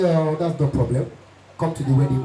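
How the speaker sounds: very low, thick big-man voice; thick rich-man voice. Retold or imitated. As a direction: drop into the rich man's deep growl. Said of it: "rich men and thick voice".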